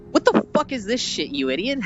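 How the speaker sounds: acted indignation, not shouted; mock irate editor. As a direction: imitate an editor berating a writer. Editor berates writer in retold scene.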